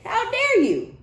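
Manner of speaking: hammed-up outraged squeal; outraged how dare you. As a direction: shriek it like an offended ex. Voicing the offended jealous ex.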